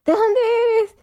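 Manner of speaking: eager curious question. Retold or imitated. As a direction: act out eagerly asking where someone is from. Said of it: "me pasaba lo que te pasa a ti".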